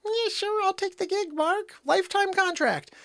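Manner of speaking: very high put-on voice; mocking Joanne imitation. Host replays coworker taking the gig, mocking high voice. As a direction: mimic a coworker in a squeaky-high pitch.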